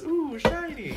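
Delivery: high, loud, far-off put-on voice; mock a dazzled magpie; high mocking coo at something shiny. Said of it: "Who just picks up glass and says".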